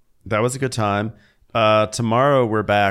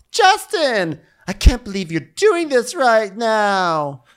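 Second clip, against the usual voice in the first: high, slowed mock indignation; mock indignant guest; 8 semitones higher, 4 dB louder, slower than usual. Guest scolding Justin mid-fight, high and indignant. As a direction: play it shocked and scolding.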